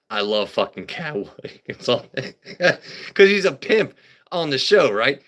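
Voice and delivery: normal talking voice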